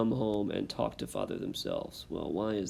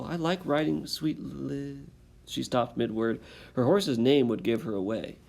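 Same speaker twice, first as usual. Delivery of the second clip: lively read-aloud, louder with rising tension; story narration; 2 semitones higher, 4 dB louder than usual. A narrator's reading. Teacher reading the exciting last page to students, dramatic storytelling. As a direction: build the chase for the listening kids.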